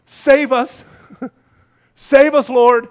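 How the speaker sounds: high, very loud cry for help; yelling plea. Acted. Crowd under oppression begging the Lord, yelled plea. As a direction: yell it out as a desperate plea. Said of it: "they're yelling".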